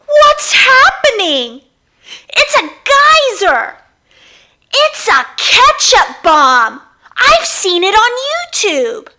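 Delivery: high, loud frightened cries; panicked kids. Acted. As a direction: scream it like the room is exploding. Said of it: "Kids sitting near the explosion began to scream".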